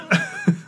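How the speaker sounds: high burst of giggling; stifled giggles. Real, not acted. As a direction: giggle escaping despite holding back. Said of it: "also try not to giggle".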